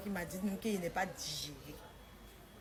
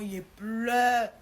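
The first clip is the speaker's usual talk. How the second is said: loud wail on a held vowel; hammed-up lament, last word drawn right out; mock wailing; 2 semitones higher, 9 dB louder than usual. Telling of tears in the car home, mock wailing. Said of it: "il dit, il pleure".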